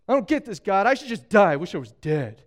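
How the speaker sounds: hammed-up despairing complaint to God; mock crying. Elijah on the run complaining to God, mock crying. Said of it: "hides, cries, and goes".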